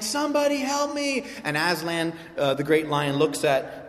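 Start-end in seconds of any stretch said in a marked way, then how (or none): 0.0-1.2 calling for help. Retold or imitated. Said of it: "I was the one saying".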